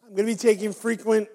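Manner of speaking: ordinary talk, nothing marked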